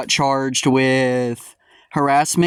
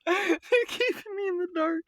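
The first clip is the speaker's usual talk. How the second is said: high-pitched far-off mimic of the caller; high mocking imitation; 13 semitones higher, 10 dB quieter than usual. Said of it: "caller's line repeated".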